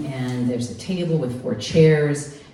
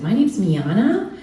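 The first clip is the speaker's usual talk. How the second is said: put-on higher, louder perky teen voice; hammed-up bright teen; younger and spunkier; 4 semitones higher, 4 dB louder than usual. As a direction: sell yourself as a bubbly teenager. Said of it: "seem younger and spunkier".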